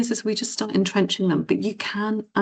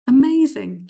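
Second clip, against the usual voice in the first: higher, louder glowing praise; caring coach praise; 6 semitones higher, 6 dB louder than usual. Retold or imitated. Running app coach praising progress, loud high praise. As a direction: sell the word like a proud coach. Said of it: "Caring coaches".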